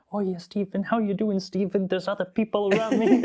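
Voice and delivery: put-on high-pitched, furtive British accent; mock British accent. Friend among other skydivers, high mock British accent. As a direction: play friend keeping up the silly British voice. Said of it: "Just quietly whispering it".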